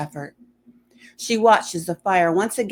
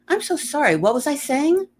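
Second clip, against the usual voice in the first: higher, flustered apology; scatter-brained, catching herself; higher flustered character voice; flustered woman; 10 semitones higher than usual. Acted. Alicia confused, voiced character.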